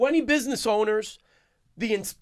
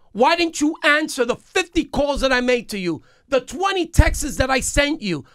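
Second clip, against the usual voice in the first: raised, higher-pitched reproach; indignant confrontation; 4 semitones higher than usual. Retold or imitated. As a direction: confront the mayor with pent-up indignation. Retold angry confrontation with the mayor.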